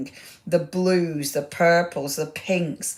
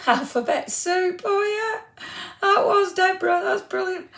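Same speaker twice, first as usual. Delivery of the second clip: laughing; 14 semitones higher than usual. Real, not acted. Laughs at viewer's joke.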